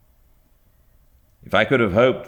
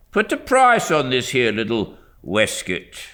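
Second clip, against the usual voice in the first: lightly voiced haggling old dealer; a hint of a grumbling shopkeeper; gruff shopkeeper; 8 semitones higher, 4 dB louder than usual. A narrator's reading. Shopkeeper haggling with a boy over a waistcoat, lightly gruff.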